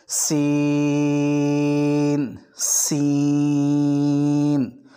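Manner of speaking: سین with a long hissing س, drawn out; whistling seen. Shown as a demonstration. Teacher shows the whistling سین, long and sung. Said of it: "سین جیسے سیٹی مارتے ہیں نا".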